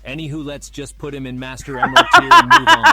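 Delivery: loud laugh-through line. Acted. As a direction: push on while cracking up. Moving on while laughing.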